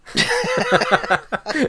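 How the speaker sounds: very high-pitched laugh; sudden squeal of laughter. Real, not acted. Laughing at the Australian joke.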